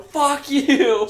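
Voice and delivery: high, loud mock yell. Friend imitating Julia yelling at people below, mock yell. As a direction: scream it down at the crowd. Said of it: "Julia is just yelling".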